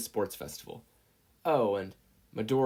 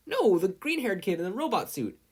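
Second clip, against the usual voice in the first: character voice; 10 semitones higher, 5 dB louder than usual. A narrator's reading. Another character's reply.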